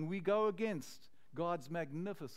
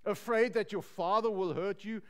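a mocking, louder jab at Jen; sarcastic taunt; 6 dB louder than usual. A girl at the party taunts Jen, sarcastic and louder. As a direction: needle her with a sneer. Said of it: "one of the girls asked sarcastically".

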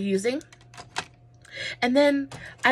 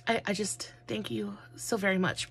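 real whispered thanks, choked with feeling; emotional whisper; 7 dB quieter than usual. Real, not acted. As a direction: whisper your gratitude as the tears come. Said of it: "I'm getting emotional".

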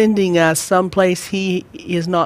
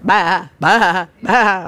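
sheep bleating; much slower than usual. Retold or imitated. Preacher imitating sheep moved down the road, mock bleating.

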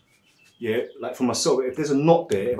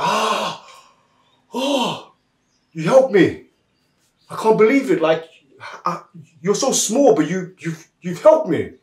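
higher-pitched storybook astonishment; amazed relief; 7 semitones higher, 4 dB louder than usual. Acted. Elephant thanking small mouse for pulling thorn, amazed. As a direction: sigh with relief, then marvel.

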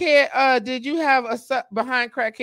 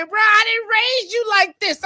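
higher, slow mock shout; exasperated parent scolding, hammed up; scolding mother; 11 semitones higher, 4 dB louder, slower than usual. Man acting his mama scolding him, exasperated.

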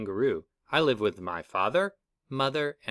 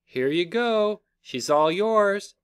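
put-on higher, slower motherly voice handing the baby over; a kind mother giving the baby away, pitched up; mother kangaroo's voice; 9 semitones higher, slower than usual. Mother kangaroo hands the baby to the hopsalots, higher and kindly. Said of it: "she thought".

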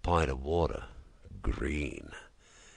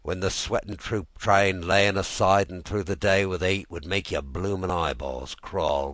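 ordinary delivery, nothing marked

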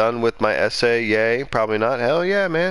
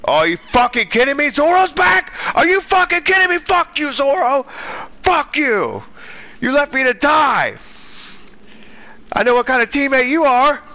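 furious yelling; 12 semitones higher than usual. Real, not acted. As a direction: scream it out, outraged and betrayed. Furious gamer rant at teammate.

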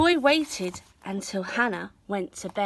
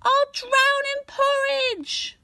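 much higher, slow, frantic wail; boy fearing he'll drown in porridge; panicked boy; 4 dB louder, slower than usual. A narrator's reading. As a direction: cry out in terror.

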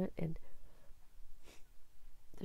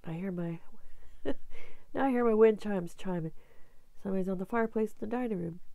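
normal talking voice

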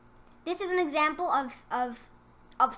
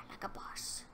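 whisper; 17 dB quieter than usual. Real, not acted. Gloating whisper after a kill.

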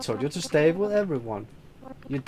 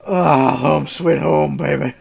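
gloating drawl; 8 dB louder, slower than usual. Acted. Proud arrival at trash HQ.

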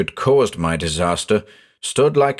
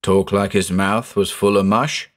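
usual speaking voice

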